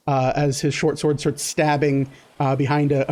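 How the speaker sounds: ordinary talk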